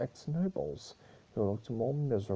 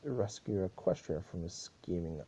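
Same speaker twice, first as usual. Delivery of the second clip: ordinary delivery, nothing marked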